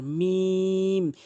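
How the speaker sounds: মিম held very long on one even note; drawn out. Shown as a demonstration. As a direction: stretch মিম out slowly. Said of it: "এভাবে টেনে উচ্চারণ করবেন". (মিম অনেক টেনে)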